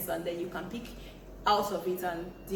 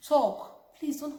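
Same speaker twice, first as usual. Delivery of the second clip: loud urging call; 8 semitones higher, 6 dB louder, much slower than usual. Real, not acted. Urging men to share feelings.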